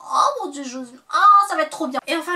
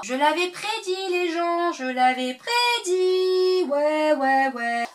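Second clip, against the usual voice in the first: drawn out, much higher and louder, gloating; triumphant; 6 semitones higher, 4 dB louder, much slower than usual. Real, not acted. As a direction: crow slowly that you called it. Gloating about a correct prediction. (triomphant, lent, aigu et fort)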